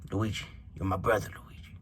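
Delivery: hushed mafia boss, trailing into a whisper; Mario. Acted. Mario as mafia boss to his brother Luigi, hushed appeal. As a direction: appeal softly to a brother, under the breath. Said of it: "Mario is a freaking Italian mafia freaking boss".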